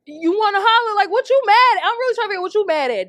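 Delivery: high, loud, fast ranting at a troll; exasperated outburst. Real, not acted. Ranting at the man in her comments, loud and exasperated. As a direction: call him out, voice climbing high. Confronting a man who hollered.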